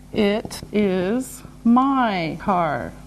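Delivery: word by word, softly; slow and deliberate. Shown as a demonstration. Teacher modelling the answer, slow and separated. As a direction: separate every word for learners.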